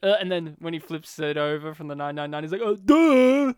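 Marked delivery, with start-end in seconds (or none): 2.6-3.5 loud, high dopey duh; dumb guy duh. Retold or imitated. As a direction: slap your forehead dopily. Dumb guy realizes mistake.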